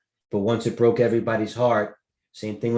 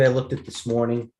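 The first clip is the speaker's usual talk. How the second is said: ordinary talk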